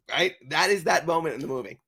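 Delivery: usual speaking voice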